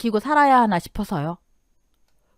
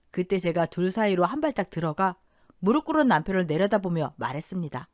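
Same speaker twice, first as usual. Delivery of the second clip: ordinary delivery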